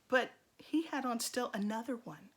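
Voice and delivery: usual speaking voice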